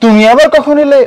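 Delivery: sudden high, loud astonished cry; surprise. Shown as a demonstration. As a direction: act startled by someone showing up. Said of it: "ওটা অবাক হওয়ার আবেগ".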